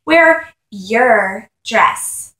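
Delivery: "dress" leaned on, very slow; stress on dress. A narrator's reading. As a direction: drag out "Wear your dress" and press on "dress". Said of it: "She emphasized the word".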